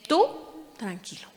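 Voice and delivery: God gently calming Samuel; gentle reassurance. Acted. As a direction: soothe him gently. God calms Samuel again.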